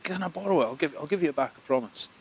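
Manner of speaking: high wheedling plea; pleading high voice. Acted. Loki pleading.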